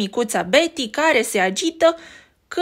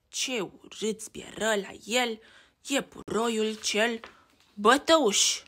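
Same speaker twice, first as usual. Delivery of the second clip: ordinary delivery, nothing marked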